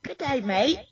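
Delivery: put-on a lightly done Australian accent with Texas showing through. Answering friends' accent game with a weak Australian accent. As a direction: say it like a Texan faking an Australian accent. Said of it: "Even that has a Texas accent".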